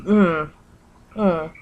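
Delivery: usual speaking voice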